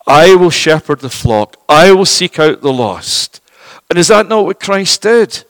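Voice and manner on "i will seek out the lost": ordinary delivery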